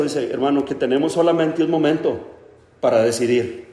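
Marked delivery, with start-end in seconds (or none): none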